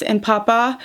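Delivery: usual speaking voice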